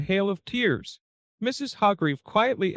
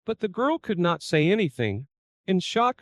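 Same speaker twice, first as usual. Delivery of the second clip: ordinary delivery, nothing marked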